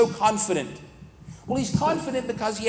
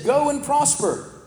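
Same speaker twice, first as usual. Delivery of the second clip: slow, higher mock encouragement; sarcastic; 6 semitones higher, 3 dB louder, slower than usual. Micaiah taunts the king, sarcastic slow go and prosper. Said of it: "in a mocking way or ... sarcastic way".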